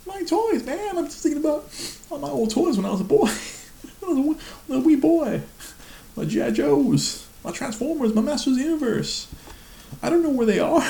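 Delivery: very high, wailing mock-sob voice; hammed-up tearful boy voice; whiny crying self. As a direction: ham up your own blubbering over lost toys. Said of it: "I'm like".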